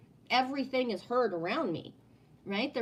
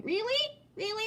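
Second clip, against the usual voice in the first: incredulous really; 12 semitones higher than usual. Real, not acted. Doubting grand past-life claims.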